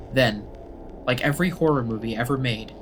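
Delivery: ordinary delivery, nothing marked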